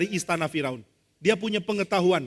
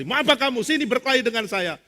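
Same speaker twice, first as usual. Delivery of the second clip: imitated bravado, pitched up; bold challenge; 6 semitones higher, 4 dB louder than usual. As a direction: dare someone to fight, cockily. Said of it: "dia berani sekali". (menantang dengan berani)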